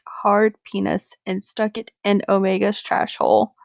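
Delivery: ordinary talk, nothing marked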